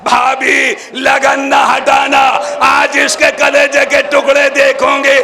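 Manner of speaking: high, loud wailing cry, slowing into chant. Acted. Zainab cries out to her sister-in-law, loud wailing chant. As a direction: cry out in grief, half sung. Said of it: "Zainab ने चिल्ला के कहा".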